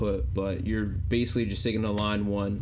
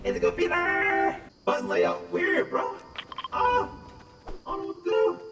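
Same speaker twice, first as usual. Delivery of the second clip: chipmunk voice. Shown as a demonstration. High voice changer while gaming.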